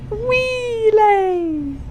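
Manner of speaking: extremely high, stretched "Wheelay" squeal; silly squeal. Pretend. Streamer tops own joke name, very high silly squeal. Repeats joke word much higher.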